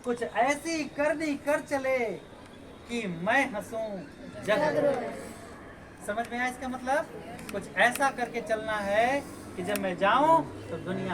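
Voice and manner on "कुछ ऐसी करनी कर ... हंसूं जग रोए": slow, loud, measured verse delivery; couplet recitation. Shown as a demonstration. Teacher quotes Kabir's line to the class, slow recitation. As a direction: recite it grandly, drawing out each phrase.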